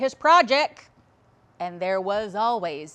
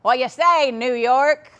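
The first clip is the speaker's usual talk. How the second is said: loud, higher imitation of a hearty dad; laughing dad; 7 semitones higher, 11 dB louder than usual. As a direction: boom out a laughing phone greeting. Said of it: "he would laugh and say".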